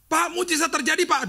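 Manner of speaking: high, excited report of a miracle; excited caller. Retold or imitated. Caller to pastor, thrilled, high and loud. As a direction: burst out with the good news.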